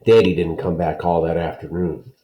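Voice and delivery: ordinary delivery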